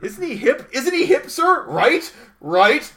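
high, loud, hammed-up sales pitch; mock fawning that pushes for agreement; eager corporate flattery. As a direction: play an over-eager honcho selling to the boss. Acting honchos selling him to boss.

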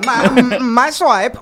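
imitating Federico. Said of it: "making fun of the way Federico talks".

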